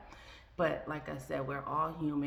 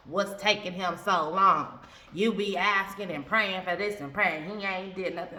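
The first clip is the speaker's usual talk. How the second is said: impatient complaint; 2 semitones higher, 8 dB louder than usual. Retold or imitated. Voicing her own impatient complaint.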